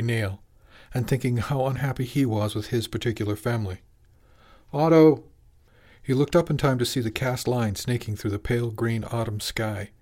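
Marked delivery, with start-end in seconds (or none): 4.7-5.2 loud call across the dock. Acted. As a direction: shout his name to get his attention. Calling out from the boat.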